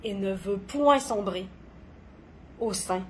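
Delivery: dramatic poetry reading with long pauses; poem recitation. Acted. Poet performing own poem on gentle madness, dramatic recitation. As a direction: recite each line deliberately, swelling and falling in intensity.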